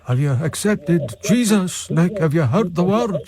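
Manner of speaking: slow Scottish preacher voice; Scottish caller preaching slowly; Scottish accent. Retold or imitated. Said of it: "You actually do a better Scottish accent".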